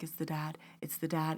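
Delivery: very soft inner prompt; whisper-soft, willing someone to hear your thought; silent urging. Retold or imitated. Telepathically telling Bree the answer, hushed urging. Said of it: "In my mind, I'm like".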